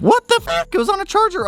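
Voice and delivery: sudden high shocked swear; shocked outburst. Real, not acted. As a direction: blurt it out in disbelief. Dead battery discovered.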